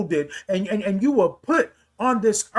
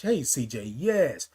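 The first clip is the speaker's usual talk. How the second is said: ordinary delivery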